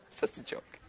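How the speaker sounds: whispered joking aside; hushed joking aside. Real, not acted. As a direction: breathe it under your breath. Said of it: "joking aside".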